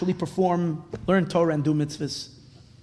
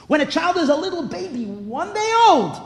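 tender; 11 semitones higher, 4 dB louder than usual. Real, not acted. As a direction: coo it like over a newborn. Describing a newborn baby.

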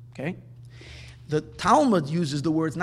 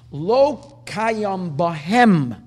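Kayam bahem drawn out very slowly, word by word; slow quoted phrase; 5 dB louder, much slower than usual. Shown as a demonstration. Teacher quotes the Medrash to students, slow and deliberate. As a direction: read the quote slowly, weighing each word.

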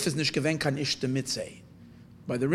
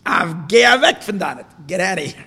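angry dismissal; 4 semitones higher, 9 dB louder than usual. Retold or imitated. Tzemach Tzedek sending the scholar away, loud and angry. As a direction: throw him out with a loud shout. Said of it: "he said to the guy".

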